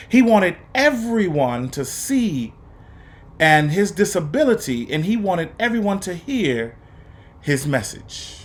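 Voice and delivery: put-on expressive read-aloud for young students; lively, paced story reading with dramatic pauses; storybook narration. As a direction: read it like a teacher sharing a picture book with the class. Teacher reads picture book aloud.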